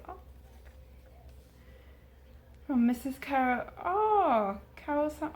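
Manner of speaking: ordinary delivery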